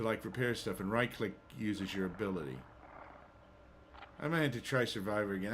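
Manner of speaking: ordinary talk, nothing marked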